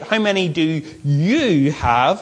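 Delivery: emphatic, lifted "you" in a slowed question; prodding. Acted. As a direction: prod them by leaning on "you". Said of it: "He's not letting it go. He prods them".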